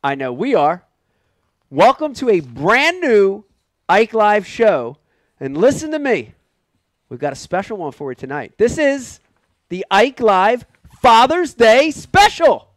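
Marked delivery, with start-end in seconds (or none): 1.7-12.7 slow, high show announcer. Acted. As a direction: sell the show opening slowly. Host announces special episode.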